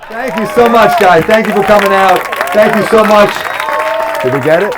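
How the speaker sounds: high, loud real shout; shouting over applause. Real, not acted. Comic closes the show to the audience, shouting. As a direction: call out thanks over a cheering crowd.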